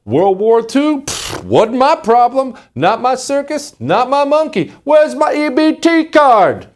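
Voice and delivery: hammed-up high mock voice, slow on the last line; mocking immigrant caricature. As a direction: squeak it out like a dismissive caricature. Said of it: "If you go pluck me out of Uganda".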